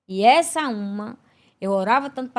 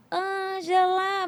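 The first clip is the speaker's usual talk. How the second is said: slow, drawn-out high call from far away; distant calling voice; 7 semitones higher, much slower than usual. Retold or imitated. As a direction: stretch the name like a voice calling from far off. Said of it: "uma voz longe lá no fim dizia". (voz distante chamando, arrastada)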